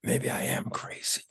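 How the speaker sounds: softly voiced panic, nearly breath only; worried whisper. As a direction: whisper the dawning fear to yourself. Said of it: "I went like".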